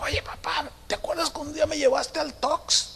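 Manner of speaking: high, soft reminiscing voice; small, high-pitched daughter; imitated daughter. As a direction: play a girl reminding her dad. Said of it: "cuando eran niños".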